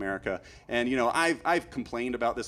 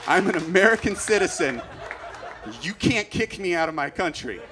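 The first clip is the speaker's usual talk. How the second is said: defiant outburst; 4 semitones higher, 4 dB louder than usual. Retold or imitated. Defiant stand to officer.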